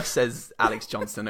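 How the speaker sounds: ordinary talk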